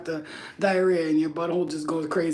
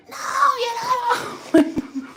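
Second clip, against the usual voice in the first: high, whiny daughter voice; daughter's whine; 4 dB louder, much slower than usual. Retold or imitated. Daughter protesting mother leaving, high whine. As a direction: squeal it like a panicked kid. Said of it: "threaten her".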